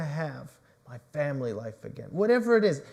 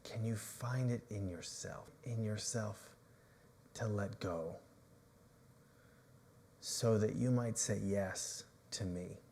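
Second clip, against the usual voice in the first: gentle Jesus; 6 semitones lower, 11 dB quieter than usual. Acted. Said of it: "he is asking Peter".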